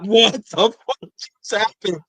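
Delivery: high, stretched-out disbelieving yell; stunned scream. Retold or imitated. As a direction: scream it at the TV in shock. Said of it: "you just hear me screaming".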